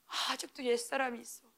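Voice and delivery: soft, sneering voice of the enemy; sly enemy taunt. Acted. Enemy whispering accusations to the believer, soft and sly. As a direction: murmur it like a tempter.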